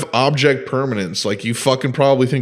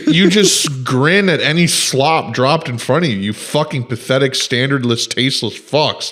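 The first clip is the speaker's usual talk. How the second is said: normal talking voice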